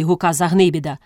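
plain reading voice

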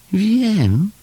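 very high little creature voice; small Chomek. A narrator's reading. Little Chomek answering Paszczak at the gate, small high voice. Story child figure voiced by narrator.

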